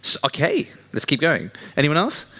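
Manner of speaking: ordinary delivery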